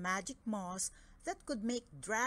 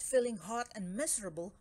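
ordinary delivery

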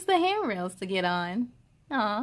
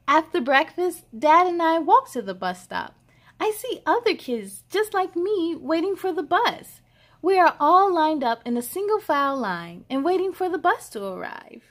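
put-on bright, lively read-aloud for kids; cheerful storybook reading; story narration; 5 semitones higher, 6 dB louder than usual. A narrator's reading. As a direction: read it like a picture book to little ones. Reads picture book aloud.

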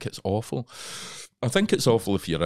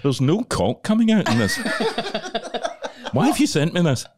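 louder, higher mock outrage with a laugh; mock indignation; 12 semitones higher, 4 dB louder than usual. Actor phoning his agent, mock indignant and laughing. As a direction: play at being scandalized.

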